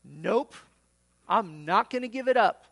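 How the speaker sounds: loud, emphatic refusal; stubborn refusal. Acted. Refusal repeated to more people.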